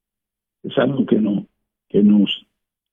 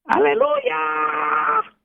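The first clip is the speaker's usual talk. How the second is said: very high, very slow drawn-out parrot squawk; much slower than usual. Retold or imitated. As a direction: stretch it out like a talking parrot. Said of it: "él te veía y te decía".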